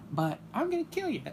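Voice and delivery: high, loud mock death threat. Viewer threatens Steve Harvey, high loud mock threat. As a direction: snap from sweet to menacing. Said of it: "I said".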